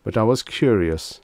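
plain reading voice

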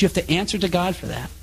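ordinary talk, nothing marked

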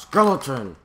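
loud, sudden yelp of alarm; startled shout. Real, not acted. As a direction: shout it out in shock. Sudden scare in game.

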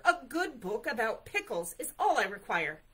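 loud, insistent bear. A narrator's reading. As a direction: demand it at full voice. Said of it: "Bear's voice was getting louder".

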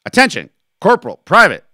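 loud, high barked order; military command. Acted. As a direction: shout them to attention, word by word. Captain calls soldiers to attention.

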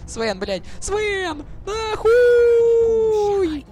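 panicked high scream; being chased. Real, not acted. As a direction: shriek in real panic. Caught by enemy, screaming.

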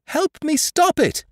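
very high, loud desperate cry; a girl begging the crew to stop the flogging; wild cry. A narrator's reading. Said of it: "she cried wildly enough".

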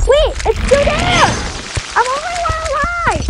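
high, excited squeal. Real, not acted. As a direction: squeal it out, thrilled. Sole survivor in game.

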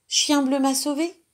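put-on high, loud excited girl. Girl telling parents the dog saved her, excited. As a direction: blurt it out thrilled.

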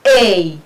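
A as a falling "eyy"; long, clear teaching A; diphthong demo. Shown as a demonstration. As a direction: model A as one glide from e to i. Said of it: "هنا تنطق على شكل".

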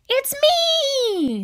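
lightly voiced storybook Anger; a hint of a character voice; playful character reveal. A narrator's reading. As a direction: announce yourself with a drawn-out flourish. Anger announces himself in story.

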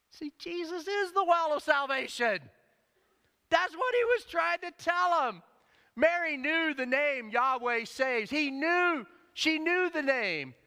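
high, loud, slow proclamation; fervent preacher's climax; full-force preaching. Real, not acted. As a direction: preach it out to the back row.

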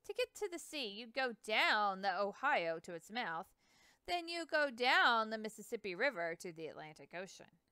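put-on a proud old boatman bragging about his dogs; Hanson's voice. Hanson voiced, river man.

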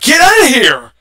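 loud get out; shouting. Acted. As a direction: shout them away. Chasing away the Titan voice.

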